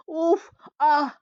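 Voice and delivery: sharp drawn-out ouch and ah groans; pained groans. A narrator's reading. Narrator voicing a man whipped by branches, groaning in pain.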